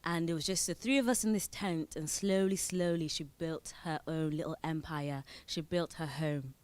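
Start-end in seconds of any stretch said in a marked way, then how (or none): none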